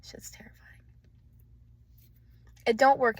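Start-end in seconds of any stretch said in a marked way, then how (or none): 0.0-0.8 hushed, breathy whisper. Real, not acted. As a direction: barely voice it, intimate. Drops into a whisper.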